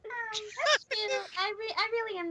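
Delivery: high, soft cutesy voice; high and soft, slipping into a cartoon voice; [name] voice. Real, not acted. Insisting it is not put on, high [name] voice. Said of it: "you sound more like Minnie Mouse".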